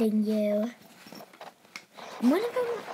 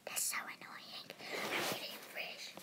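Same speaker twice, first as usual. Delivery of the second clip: hushed unvoiced aside; whisper; 13 dB quieter than usual. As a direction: breathe it out under your breath.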